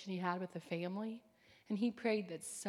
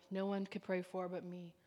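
usual speaking voice

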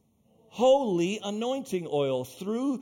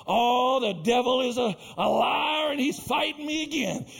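mock complaint; 5 semitones higher than usual. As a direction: cry it out, hamming up the grievance. Mocks churchgoers blaming the devil.

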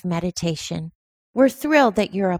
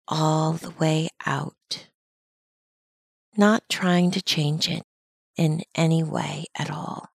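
calm, unhurried meditation guide, long pauses between phrases; measured mindfulness-teacher delivery with lingering silences; guided meditation. Acted. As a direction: guide listeners gently into their breathing, leaving space after each phrase. Leads a breathing meditation.

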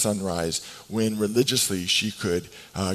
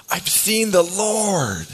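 excited proclamation; 10 semitones higher, 5 dB louder, much slower than usual. Acted. Mary brings the news.

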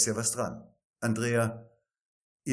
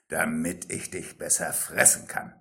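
wolf lowering voice; 6 semitones lower than usual. A narrator's reading. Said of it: "antwortete der Wolf".

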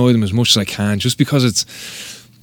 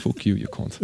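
much softer, under the breath; muttered insult; 12 dB quieter than usual. Retold or imitated. Retold insult muttered at judge.

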